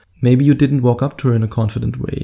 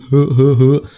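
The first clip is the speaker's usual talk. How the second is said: loud, put-on dopey chuckle; mock goofy laugh; 5 dB louder than usual. Guy with no goal talking to a girl, mock goofy laugh. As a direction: laugh like a clueless loafer.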